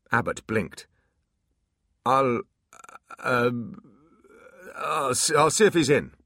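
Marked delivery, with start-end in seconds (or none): 2.1-6.1 nervous stammer. Acted. Demon suddenly cowed.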